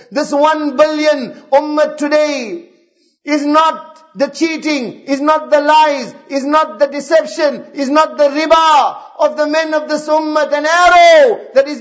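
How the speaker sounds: forceful exhortation with surging stressed words; impassioned preaching. Real, not acted. Preacher lamenting the ummah's state today, impassioned full-force exhortation. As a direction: drive each accusation home, swelling on key words.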